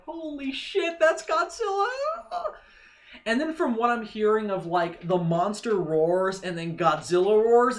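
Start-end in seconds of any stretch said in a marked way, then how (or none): 0.0-1.9 high, laughing burst of fanboy awe; imitating awed excitement. Fan reliving the trailer moment, high laughing awe. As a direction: squeal it like a kid seeing his hero. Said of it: "I'm just like".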